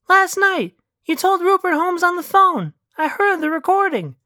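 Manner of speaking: put-on high-pitched girlfriend voice; high character voice, pleading her case; insistent Elena. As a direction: confront a lover in a high, put-on voice. Plays Elena confronting Patty.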